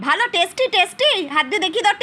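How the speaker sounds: put-on baby talk. Mother cheering her little daughter eating chaumin, high sing-song baby talk. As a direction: coo and cheer the toddler on. Mother coaxing toddler to eat.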